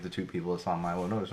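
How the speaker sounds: ordinary delivery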